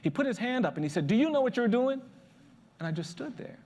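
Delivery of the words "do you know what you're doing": raised, louder challenging question; stern old man. Retold or imitated. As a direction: confront him like a stern elder. Stern old man confronts him.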